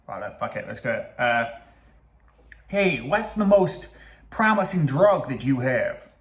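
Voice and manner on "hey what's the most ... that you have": slow, drawn-out attempted Texan accent; hammed-up American accent, Texas style; mock Texas accent. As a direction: stretch it out like a fake Texan. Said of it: "He's Texas".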